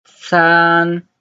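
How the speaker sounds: San held high and level; first tone. Shown as a demonstration. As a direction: stretch San on one steady high note. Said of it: "the first tone".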